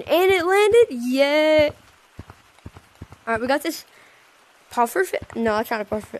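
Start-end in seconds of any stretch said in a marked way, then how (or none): 0.0-1.7 high loud victory cheer; excited cheer. Real, not acted. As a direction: celebrate the landing. RC plane landing.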